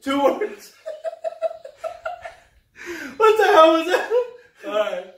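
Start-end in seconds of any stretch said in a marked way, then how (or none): 0.0-0.8 laughing. Retold or imitated. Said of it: "Did you see that?". 3.2-4.1 laughing. Real, not acted. Laughing hard.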